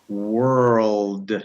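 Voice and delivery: "world" stretched so every sound shows; drawn-out WORLD. Shown as a demonstration. Teacher putting it all together, drawn-out word. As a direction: slowly blend w, er, l, d in "world". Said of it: "if you put it all together".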